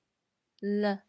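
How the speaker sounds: "了" short, light and unstressed, neutral tone. Shown as a demonstration. Teacher models Mandarin neutral tone on 了, light. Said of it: "same tone as tone zero".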